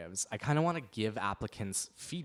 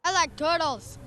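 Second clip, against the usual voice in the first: high silly meme voice; silly kid voice; 6 dB louder than usual. Pretend. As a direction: blurt it like a goofy kid. Random meme voice interjection.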